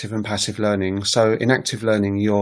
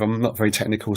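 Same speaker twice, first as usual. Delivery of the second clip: ordinary delivery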